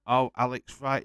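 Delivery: the penny drops, say it out loud; remembering the wrestler at last; sudden recognition. Real, not acted.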